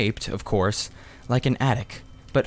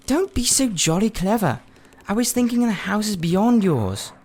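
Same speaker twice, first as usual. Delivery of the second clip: put-on higher boy's voice, mildly scornful; Diggory, a bit impatient; 10 semitones higher than usual. A narrator's reading. Diggory retorts to Polly about the houses, mock impatient boy. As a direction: brush off her joke and press on.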